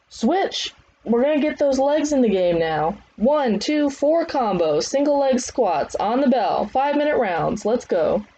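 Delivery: high, projected commands; trainer's drill calls. Acted. Trainer calling leg drills on bell, high commands. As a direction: drive the class into the next round.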